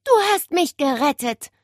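high, grateful exclamation; relieved. A narrator's reading. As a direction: thank your rescuer with relief. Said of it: "stöhnte Nele erleichtert".

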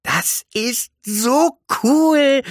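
high, loud, drawn-out delight; awestruck excitement. Acted. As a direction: gush it slowly and very high. Delighted by the new words. (begeistert, hoch und langgezogen)

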